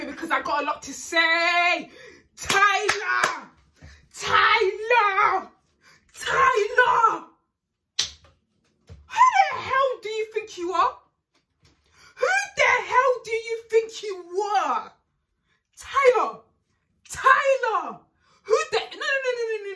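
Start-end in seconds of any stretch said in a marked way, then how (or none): 2.4-7.2 escalating angry yells; shouting a name. Real, not acted. Ranting at reality show contestant. 9.1-14.9 outraged, voice cracking with anger; shaking with anger. Real, not acted. Furious rant at contestant. 15.8-19.7 angry shout. Real, not acted. Ranting at contestant.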